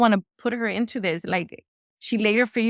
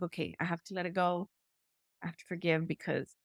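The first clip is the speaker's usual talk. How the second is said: softer self-talk; 3 semitones lower, 11 dB quieter than usual. Retold or imitated. Quoting her own resolve.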